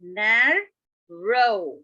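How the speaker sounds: "narrow" in two drawn-out parts, na and row; broken into syllables. Shown as a demonstration. Teacher proving two syllables, "narrow" pulled apart. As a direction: separate the syllables of "narrow" with a clear gap. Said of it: "So two syllables".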